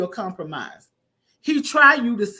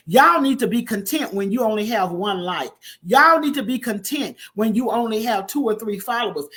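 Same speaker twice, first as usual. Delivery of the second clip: ordinary talk, nothing marked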